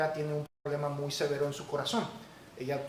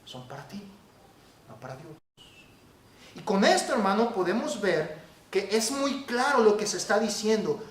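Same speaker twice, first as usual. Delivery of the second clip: normal talking voice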